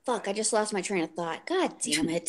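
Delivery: ordinary talk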